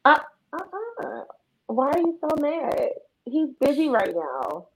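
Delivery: high, slow sweet taunting; mock innocence. Sister taunting the angry caller, high and sweet. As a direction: play dumb and sugary.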